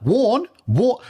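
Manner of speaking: high, loud disbelief; a sharp squeaky double take; incredulous. Real, not acted. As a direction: yelp it in total disbelief. Friend's incredulous outburst at the story.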